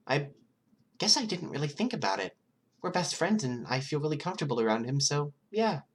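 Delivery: put-on high, playful girl's voice; Toga's voice. A narrator's reading. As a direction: pitch her up high and teasing. Toga's voice in the read story.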